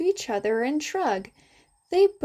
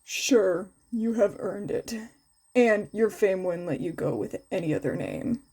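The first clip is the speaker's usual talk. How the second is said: lower, flat tired teacher; a touch lower and drowsy; lightly lower, bored man's voice; bored Shota; 4 semitones lower than usual. Acted.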